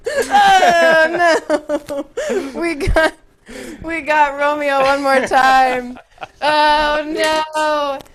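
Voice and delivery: loud, laughing, thrilled cry; laughing excited cry. Real, not acted. Teacher reacting to the game on screen, laughing and loud. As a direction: laugh and cheer at once, loud. Cheering a live class game.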